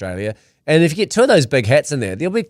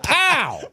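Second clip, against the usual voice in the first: mock punch; 12 semitones higher than usual. Mock punch sound effect.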